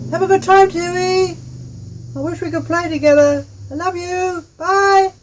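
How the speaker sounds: high, loud farewell call; calling back. Acted. Anna calling back to Tui from her house, loud and high. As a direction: call out cheerfully after her friend. Friend calls back from window.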